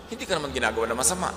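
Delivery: ordinary talk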